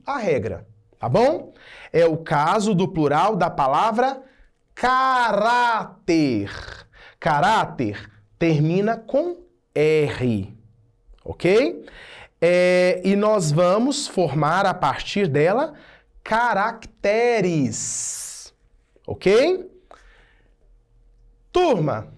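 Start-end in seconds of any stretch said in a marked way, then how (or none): 4.7-6.5 "caráter" stretched out syllable by syllable; very slow, deliberate "caráter". Shown as a demonstration. Teacher spells out key word. 16.2-18.2 "caracteres" drawn out syllable by syllable; deliberate, stretched "caracteres"; slow, careful enunciation. Shown as a demonstration. Teacher shows irregular plural.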